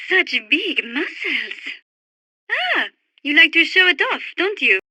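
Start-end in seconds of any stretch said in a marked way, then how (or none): 0.1-1.6 giggle, then savour it slowly; flirtatious admiration. Acted.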